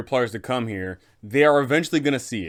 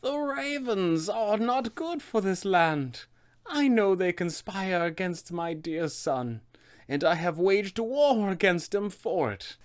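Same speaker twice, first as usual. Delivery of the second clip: high, slow, grave witch; deliberate crone voice, pitched up and ominous; Baba Lysaga; 8 semitones higher, 4 dB quieter than usual. Acted. Witch answering players about the caged ravens, slow grave crone voice. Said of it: "she will simply reply".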